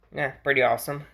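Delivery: normal talking voice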